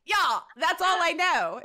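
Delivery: ordinary talk, nothing marked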